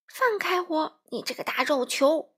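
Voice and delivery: frantic squawking. A narrator's reading. Crow pinned under the wolf, protesting squawk. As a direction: squawk to be let go. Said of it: "花袜子呱呱乱叫".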